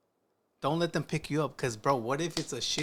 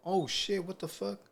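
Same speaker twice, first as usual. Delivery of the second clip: fake surprise; 7 semitones higher, 4 dB quieter than usual. Shown as a demonstration. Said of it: "let me show you".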